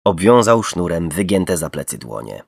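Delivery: plain reading voice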